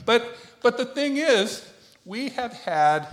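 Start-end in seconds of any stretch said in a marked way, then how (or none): none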